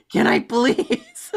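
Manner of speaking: high, loud, slow laughing plea; laughing mock plea. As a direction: beg through laughter, hammed-up. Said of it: "You're doing it".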